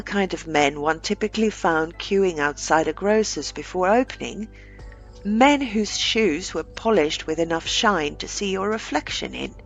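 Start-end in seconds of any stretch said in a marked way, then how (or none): none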